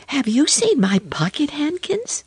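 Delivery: put-on high-pitched girl asking hopefully; little girl's question. A narrator's reading. Lucy asks after her things.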